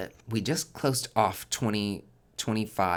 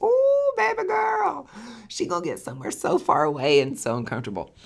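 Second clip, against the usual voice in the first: squealed high and loud, then settling; a big shocked squeal of disbelief; shocked aunt; 13 semitones higher, 6 dB louder than usual. Retold or imitated. Aunt reacting to niece stationed in Japan, high shocked squeal. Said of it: "And I was like".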